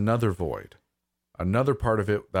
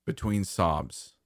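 plain reading voice

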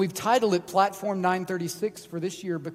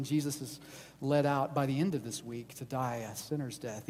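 usual speaking voice

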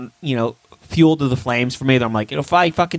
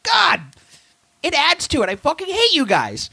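exasperated outburst; 13 semitones higher than usual. Retold or imitated. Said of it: "I was like".

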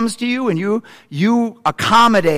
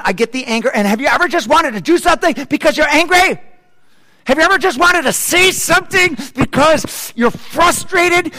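loud, high, pushy justification, fast at first; mock angry venting; 7 semitones higher, 3 dB louder than usual. Preacher voicing a frustrated believer, loud heated venting. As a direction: egg them on, feeding off the anger.

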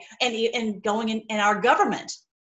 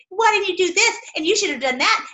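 higher, louder mock nagging; scornful whiny questioning; nagging critics; 8 semitones higher, 4 dB louder than usual. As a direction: needle him like a nagging crowd. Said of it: "The president".

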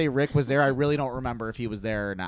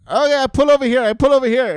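mock driver voice; 12 semitones higher, 11 dB louder than usual.

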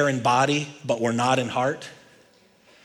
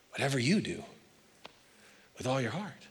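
soft repeat; 9 dB quieter than usual. Shown as a demonstration. Preacher repeating his point to the congregation, softly. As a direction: drop to a much softer echo.

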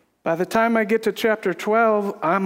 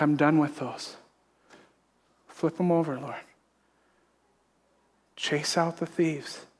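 quiet prayer; 5 semitones lower, 6 dB quieter than usual. Retold or imitated. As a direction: pray it under your breath.

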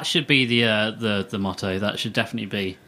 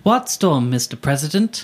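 lightly played reporter, higher and louder at the start; mock reporter; 5 semitones higher, 4 dB louder than usual. Co-host quizzing the pretend president, lightly acted reporter. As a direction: put a pressing question as a play reporter. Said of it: "I'll be the reporter".

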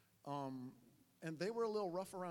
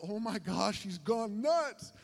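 louder, stretched-out disbelief; mock alarm; 3 semitones higher, 6 dB louder, slower than usual. Sick student watching host mother rant, exaggerated alarm. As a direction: exclaim it, dragging out the last words. Reacting to the ranting lady.

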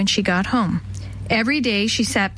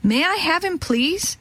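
put-on high, pleading child's request; eager cry; 8 semitones higher, slower than usual. A narrator's reading. Alice begs the old woman for the bird, crying out. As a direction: ask eagerly, pitched up. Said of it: "cried Alice".

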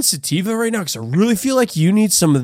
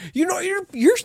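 higher-pitched, exasperated rant; fed-up outburst; 7 semitones higher than usual. Acted. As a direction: blow up at your roommate and storm off. Accusing friend before leaving.

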